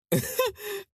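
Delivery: high burst of laughter; high amused laugh. Real, not acted. Laughing at the absurd situation.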